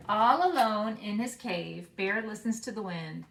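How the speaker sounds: put-on expressive read-aloud for young children; story narration. Teacher reading a picture book to her class, expressive read-aloud. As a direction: read the picture book warmly and slowly, bringing each animal to life.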